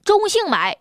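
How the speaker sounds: ordinary talk, nothing marked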